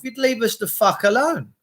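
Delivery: usual speaking voice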